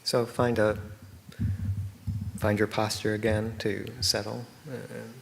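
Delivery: unhurried meditation guidance with long silences; guided meditation. Real, not acted. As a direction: guide them gently into stillness, leaving space between phrases.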